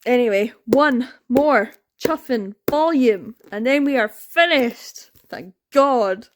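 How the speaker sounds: slow, emphatic, word by word; fed up. Real, not acted. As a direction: punch each word with weary relief. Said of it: "I'm sick of this".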